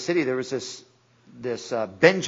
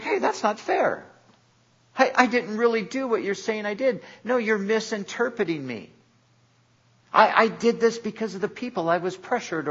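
making excuses; 7 semitones higher than usual. Retold or imitated. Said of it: "He would always come up with an excuse".

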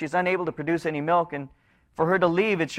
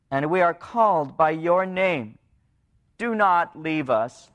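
scripture reading; 4 semitones lower than usual. Acted. Preacher reading the people's plea, low and prayerful.

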